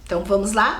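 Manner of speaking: ordinary talk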